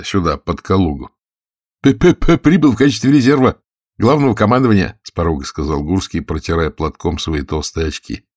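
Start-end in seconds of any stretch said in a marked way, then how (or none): 1.8-5.0 high, loud stutterer reporting in; stuttered, raised-voice mock-official announcement; stuttering Gursky. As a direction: stumble on the P, then push the sentence out.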